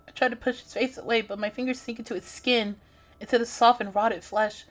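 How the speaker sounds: usual speaking voice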